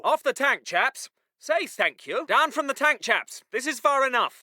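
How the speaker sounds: low, easy officer's instruction; calm polite order. Acted. Officer letting chaps off the tank, calmly polite. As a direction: tell them gently, dropping low on the last word.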